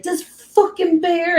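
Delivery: high, loud cursing; mad outburst. Retold or imitated. Childhood viewer cursing the cartoon bear, mad. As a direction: burst out at the screen in annoyance. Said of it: "I get so mad".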